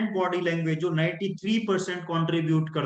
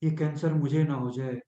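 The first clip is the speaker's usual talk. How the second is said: fearful tone; 5 semitones lower than usual. Shown as a demonstration. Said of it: "वो tone specific होगी".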